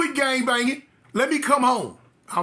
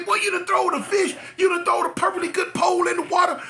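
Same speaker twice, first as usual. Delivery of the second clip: uncle yelling; 9 semitones higher, faster than usual. Retold or imitated. Uncle yelling at the nephew who lost the pole, angry shout. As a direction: chew the boy out for losing the pole.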